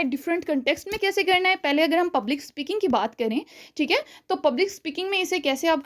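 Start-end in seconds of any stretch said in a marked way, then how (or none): none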